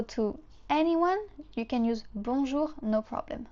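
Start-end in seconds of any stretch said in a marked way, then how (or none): none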